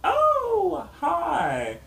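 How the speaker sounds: very high bright sing-song "Oh, hi"; overly friendly high-pitched hello; chirpy greeting. Retold or imitated. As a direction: greet him in a sweet, high voice. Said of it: "I walk in and I'm like".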